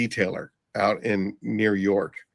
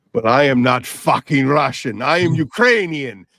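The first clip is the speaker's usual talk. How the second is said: loud, indignant imitation of an Eastern European accent; mock-outraged retort in a Russian-style accent; heavy Russian-style accent; 2 semitones higher, 6 dB louder than usual. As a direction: imitate an offended Ukrainian with a thick accent, rising in pitch. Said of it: "very heavy, what I thought was".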